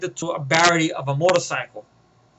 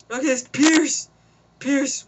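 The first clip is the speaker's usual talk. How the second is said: higher, far-off voice giving in; broken confession; 6 semitones higher, slower than usual. Retold or imitated. Villain confessing the boss's name, beaten. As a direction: give up the name.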